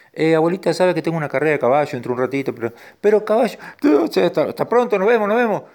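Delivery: high, rushed excuse; horse making excuses. Acted. Horse telling grandma he has a race soon, hurried excuse. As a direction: gabble a hasty, high-pitched goodbye.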